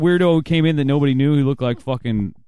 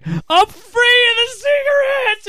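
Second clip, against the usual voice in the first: preacher shout; 4 dB louder, much slower than usual. Retold or imitated. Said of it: "And he's like".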